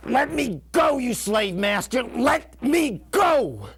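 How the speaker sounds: full-force, high-pitched shout; screamed demand to be released, drawn out at the end; yelled protest. Retold or imitated. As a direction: yell it out to break free of a grip. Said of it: "And I yell to him".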